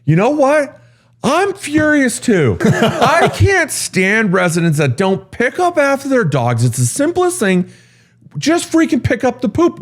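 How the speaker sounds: high-pitched, hammed-up furious rant; mock yelling back. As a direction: act out a boss yelling back in exasperation. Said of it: "now he's yelling back at this resident".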